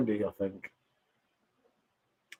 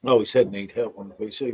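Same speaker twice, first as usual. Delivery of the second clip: normal talking voice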